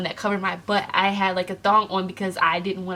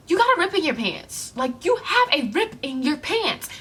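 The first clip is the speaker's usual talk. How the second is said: tag insistent stranger; 7 semitones higher than usual. Retold or imitated. Another stranger insists, retold.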